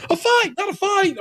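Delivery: high mock British voice, correcting; hammed-up imitation of a British accent; Allison's British accent. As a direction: pitch way up and put on the accent. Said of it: "she might as well just said the same words".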